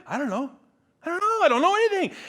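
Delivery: high, loud mock exasperation; exasperated outburst. Pastor answers pestering church people, exasperated outburst. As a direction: burst out high, at wit's end.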